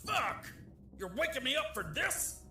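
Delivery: high furious outburst; angry outburst. Acted. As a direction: explode at being woken. Woken officer explodes.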